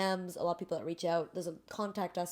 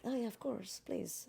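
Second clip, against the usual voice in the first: casual bluff; 3 semitones higher, 8 dB quieter, slower than usual. Retold or imitated. Bluffing knowledge in anecdote.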